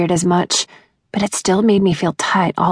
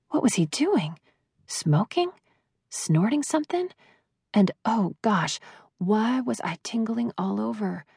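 hushed; 3 semitones higher, 9 dB quieter than usual. A narrator's reading. Racing heart, secret thoughts.

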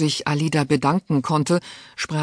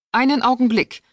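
higher, louder call to stop her; calling after; 10 semitones higher, 4 dB louder than usual. A narrator's reading. Duchess calling after Alida at the door, sharp call. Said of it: "rief ihr die Herzogin nach".

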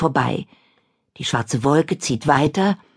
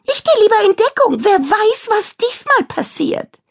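high nervous witch; 4 dB louder than usual. Acted. Kadabra ducks for cover.

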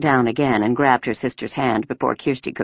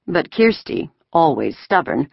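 ordinary delivery

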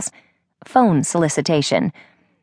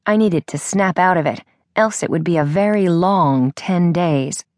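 usual speaking voice

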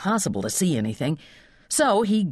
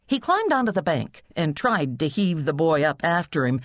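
usual speaking voice

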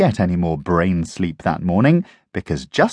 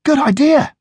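high, loud excited puppy chorus; eager agreement; 5 dB louder than usual. Acted. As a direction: jump at the plan. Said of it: "the others agreed".

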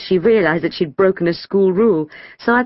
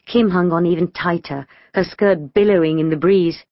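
plain reading voice

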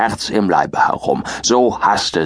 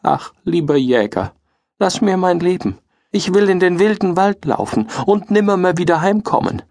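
tearful pleading; 7 semitones higher than usual. A narrator's reading. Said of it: "fing es an zu weinen".

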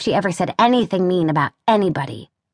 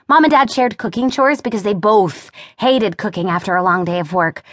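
ordinary delivery, nothing marked

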